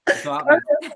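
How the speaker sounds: high, loud, giving up in laughter; laughing too hard. Real, not acted. Speaker abandoning the Rocky impression, laughing too hard. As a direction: squeeze it out through the giggles.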